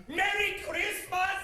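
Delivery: slow, high shout ending in laughter; joyous shout. Acted. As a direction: bellow it to the whole street. Scrooge cries out his greeting.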